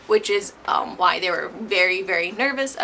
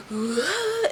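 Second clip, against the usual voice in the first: high, re-enacted gasp and yelp; acted startled gasp; 9 semitones higher than usual. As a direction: mimic jolting out of a nightmare. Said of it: "and I was like".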